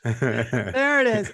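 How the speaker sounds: very slow, low villain voice; mustache twirling villain. Pretend. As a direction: drag it out like a stage villain. Guest plays along dramatically.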